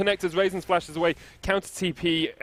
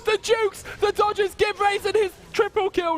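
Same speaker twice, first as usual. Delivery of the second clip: very high, fast hype call; excited shriek; slower than usual. Real, not acted. Caster shrieking over the dodges, excited. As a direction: scream the jukes out.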